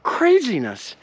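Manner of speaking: high-pitched, incredulous retold outburst; retold disbelief. Retelling his shocked reaction.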